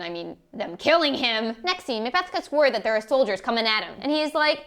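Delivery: ordinary delivery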